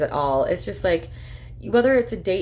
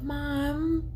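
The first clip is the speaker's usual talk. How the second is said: imitating daughter; 9 semitones higher, 5 dB quieter than usual. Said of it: "And Asha's like".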